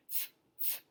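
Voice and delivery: "f" as voiceless air, no vowel after it; very soft, sustained "f" through teeth and lip; pure F sound. Shown as a demonstration. Teacher showing what sound F makes, breath-only "f". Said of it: "F makes a f, f sound".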